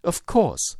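high, loud burst of excitement; excited yell. Acted. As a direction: cry out in triumph. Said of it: "yelled Holmes, in a paroxysm of excitement".

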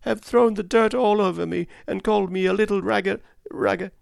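put-on a hurt child sobbing out her complaint. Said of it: "she sobbed".

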